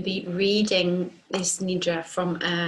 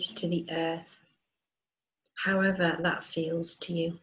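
calm, unhurried meditation voice with long pauses; guided meditation. Acted. Teacher guiding listeners to feel grounded and rooted, guided meditation. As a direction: guide them gently into rest, leaving space between phrases. Leads a yoga nidra meditation.

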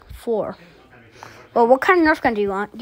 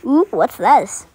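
ordinary talk, nothing marked

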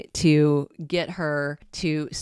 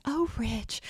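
soft breathy sigh, higher pitch; 5 semitones higher, 6 dB quieter than usual. Retold or imitated. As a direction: breathe his name out like a seductress. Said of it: "she does this very breathy voice".

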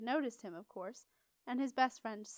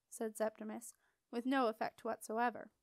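usual speaking voice

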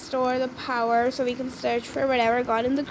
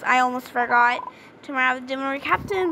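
ordinary talk, nothing marked

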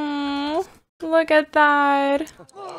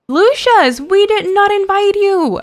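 calling out; 7 semitones higher, 6 dB louder than usual. Real, not acted. Game character shows up uninvited.

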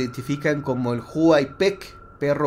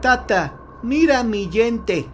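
put-on child's voice; 7 semitones higher, slower than usual. Monster child's plea in legend.

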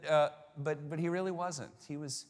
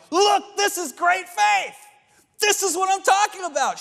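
excited Jesus shout; 11 dB louder than usual. Retold or imitated. Jesus jumping up and down, praising her great faith, in a loud excited shout. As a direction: proclaim it like he's jumping for joy. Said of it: "jump up and down and go".

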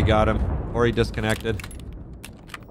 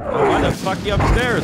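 yelling across the room; 7 semitones higher, 4 dB louder than usual. Real, not acted. Calling to teammate upstairs.

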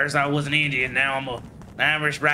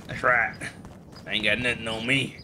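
ordinary talk

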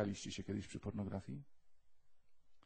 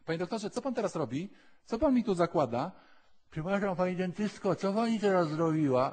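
high, louder anxious questioning; fussy patient; 7 semitones higher, 8 dB louder than usual. Retold or imitated. A patient pesters the dentist, high and anxious.

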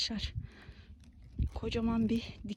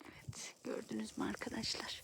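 soft unvoiced whisper; 11 dB quieter than usual. Real, not acted. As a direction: keep it to a whisper. Whispering while foraging.

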